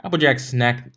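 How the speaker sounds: plain reading voice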